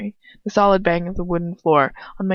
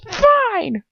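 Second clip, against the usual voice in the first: much higher snapped shout; yelled; 13 semitones higher than usual. Acted. Furious wizard yelling at the defiant girl. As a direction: yell it back. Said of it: "He yells".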